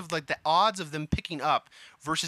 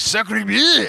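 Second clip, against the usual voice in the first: exclaimed higher and louder in exaggerated French; mock French accent; 6 semitones higher, 8 dB louder, much slower than usual. Guest teasing that this is all French sounds like, mock French accent. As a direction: put on a cartoonish French accent, loud. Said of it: "French just sounds like".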